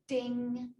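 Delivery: high, loud sung-out ding; sustained mock chime; imitated bell ding. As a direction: ring out like a bell of realisation. Imitates moment of realisation.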